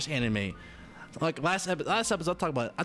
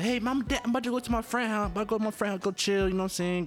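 higher, lightly voiced eager kid; a hint of a kid's voice; kid asking parents; 7 semitones higher, faster than usual.

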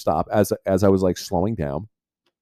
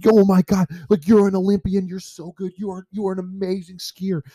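higher, gushing hyped friend; pumped buddy; 10 semitones higher than usual. Retold or imitated. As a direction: rave like an amazed buddy.

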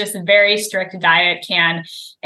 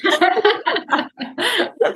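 high burst of laughter; laughing; 13 semitones higher than usual. Real, not acted. Host cracking up at guest's muddled saying, laughing.

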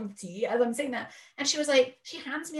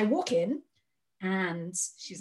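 normal talking voice

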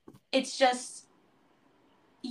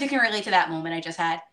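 usual speaking voice

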